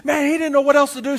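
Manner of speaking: ordinary talk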